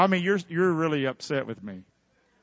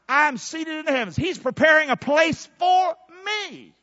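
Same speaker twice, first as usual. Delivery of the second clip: exultant preaching; 7 semitones higher, 4 dB louder than usual. Real, not acted. Preacher declaring he is raised up, exultant preaching. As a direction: ring it out as a triumphant declaration.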